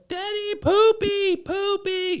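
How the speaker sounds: put-on high, loud, slow kid's voice; toddler calling. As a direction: drag out poopy like a small child. Toddler announcing dirty diaper.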